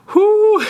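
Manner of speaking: high sharp exhale fighting back tears; tearful whew. Real, not acted. Owner grieving his dead pet lets out a tearful whew. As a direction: blow out hard to keep from crying. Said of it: "Don't wanna cry".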